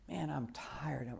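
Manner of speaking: soft, slow, worn-out murmur; drained inner voice after a long night; tired thought. Retold or imitated. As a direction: let the exhaustion drag the words. Exhausted sailor's inner thought.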